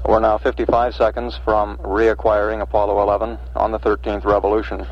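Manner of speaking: usual speaking voice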